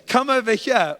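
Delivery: high, loud playful come-on; flirty invitation. Acted. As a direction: tease it like a suitor calling his date over. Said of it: "Boaz said to her".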